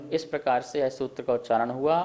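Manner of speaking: ordinary talk, nothing marked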